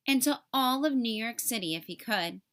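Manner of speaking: plain reading voice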